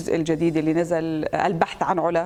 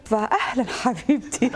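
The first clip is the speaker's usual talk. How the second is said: drawn-out, gushing hello; mock-warm welcome, stretched out; effusive greeting; 3 semitones higher than usual. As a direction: greet her as if you remember her. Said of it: "أستقبلها بحرارة".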